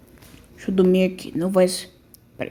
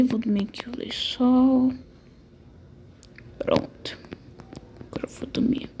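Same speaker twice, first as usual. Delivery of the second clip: whispering while hiding; 7 dB quieter than usual. Pretend. Doll bedtime, keeping quiet.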